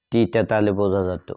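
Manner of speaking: ordinary talk, nothing marked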